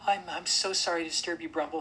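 high, loud nervous stammer; stammering apology. A narrator's reading. Mona apologises to the woken bear, high nervous stammer. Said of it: "stammered Mona".

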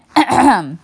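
a small polite throat clear. A narrator's reading. Girl clears throat before addressing the bear. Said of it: "She cleared her throat again".

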